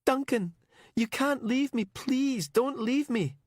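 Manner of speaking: high, tearful begging; desperate pleading. Acted. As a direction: beg him not to leave. Begging dying man not to go.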